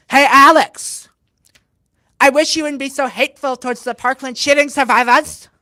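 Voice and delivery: loud, high imitation of the heckler; mock reproachful call. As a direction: call it out as a mocking put-on reproach. Imitating heckler at baggage claim.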